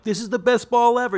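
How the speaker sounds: high, loud, hammed-up TV ad voice; infomercial pitchman. Old TV ad selling golf balls, hammed-up pitchman. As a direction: sell it like a cheesy old golf ball commercial. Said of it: "put on T V, like".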